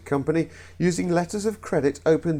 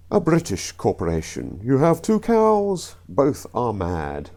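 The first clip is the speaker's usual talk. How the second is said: put-on British accent. Comedian telling the British corporation joke, hammed-up British accent. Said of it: "I know the accents were awful".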